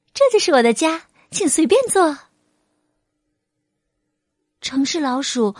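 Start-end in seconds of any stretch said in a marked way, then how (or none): 0.1-2.2 happily. A narrator's reading. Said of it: "他高兴地说".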